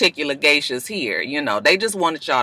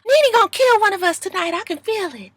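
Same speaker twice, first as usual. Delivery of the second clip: Portia impression. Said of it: "Portia was just like".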